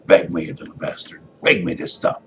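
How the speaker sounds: loud, harsh taunting; cruel taunt. Acted. Father beating Evan, loud cruel taunt. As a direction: bark it down at the helpless boy.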